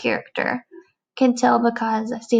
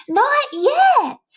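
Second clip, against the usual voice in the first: high squeaky bug; 5 dB louder than usual. Acted. Caterpillar voice.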